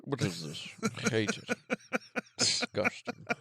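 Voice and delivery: low, soft creature voice; alien chestburster voice. Pretend. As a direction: hiss the disgust as the alien. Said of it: "I don't know what the voice is".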